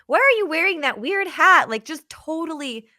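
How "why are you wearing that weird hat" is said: high, loud, scoffing put-on voice; wife laughing off her husband's sad moment, higher and louder; mocking wife. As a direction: brush him off with a mocking jeer. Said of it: "she laughed at him and was like".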